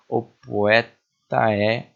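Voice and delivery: "O poeta" drawn out very slowly, "o" and "po" run together; slow linked syllables. Shown as a demonstration. Teacher showing students how vowels join in speech. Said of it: "a gente fala junto o finalzinho".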